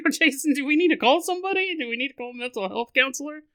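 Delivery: high, worried fake concern; mock worried listener. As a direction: act out a fretting fan. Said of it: "You're like".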